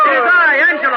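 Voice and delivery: high, loud call over the noise. Acted. Angelo answers Fortunato over the carnival din, loud and high. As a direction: shout it above the crowd.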